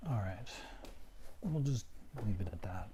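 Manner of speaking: all right whispered under his breath. Real, not acted. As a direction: mutter it softly to yourself, almost no voice. Muttering to himself after glitch.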